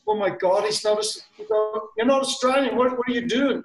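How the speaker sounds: high-pitched attempt at an Australian accent; mock-scandalised Australian. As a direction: put on a rough Australian accent, indignant. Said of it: "That was almost a good Australian accent".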